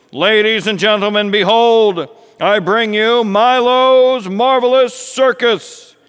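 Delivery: high, loud ringmaster bellow; booming circus intro, slowing grandly at the end; grand announcement. Acted. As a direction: announce the show to a packed tent. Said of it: "Milo came out and he announced".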